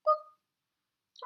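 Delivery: bright final dot sound; high boop. Shown as a demonstration. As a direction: squeak out one last high boop. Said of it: "Lots of short sounds".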